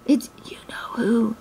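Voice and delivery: slow, hushed whisper; drawn-out secretive whisper; alarmed whisper. A narrator's reading. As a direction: whisper it so nobody outside hears. Rabbit hiding from Tigger, whispers.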